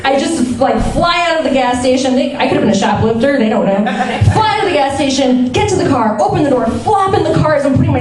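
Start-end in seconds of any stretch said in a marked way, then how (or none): none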